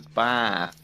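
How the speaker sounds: BATH with a long, drawn-out ah; slow long-ah Bath; extended ah. Shown as a demonstration. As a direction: stretch the vowel in Bath to aah. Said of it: "like, an extended ah".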